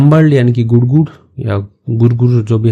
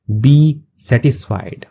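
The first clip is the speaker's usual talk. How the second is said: slow teacher read; much slower than usual. Shown as a demonstration. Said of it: "teacher reads slowly".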